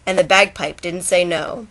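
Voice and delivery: sing-song storytelling read; poem recitation. A narrator's reading. Vlogger reading Shel Silverstein to viewers, rhythmic recitation. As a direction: read it like a bedtime rhyme.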